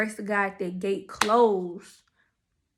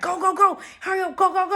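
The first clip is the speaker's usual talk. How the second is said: much higher, louder frantic urging; panicked hurry; 9 semitones higher, 5 dB louder than usual. Retold or imitated. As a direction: hurry her like the cops are coming. Friend panicking, rushing to the car.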